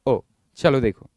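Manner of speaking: usual speaking voice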